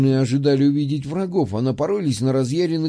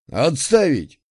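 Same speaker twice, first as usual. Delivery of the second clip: commanding bark; slower than usual. A narrator's reading. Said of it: "рявкнул таким командирским властным басом".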